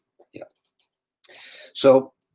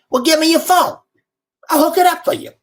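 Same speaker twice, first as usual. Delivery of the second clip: much higher, louder New Orleans hustler voice; New Orleans accent; 4 dB louder than usual. Retold or imitated. [name] offering to fix the phone in a New Orleans accent. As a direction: offer the favor like a slick New Orleans fixer. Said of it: "in a very raspy New Orleans accent".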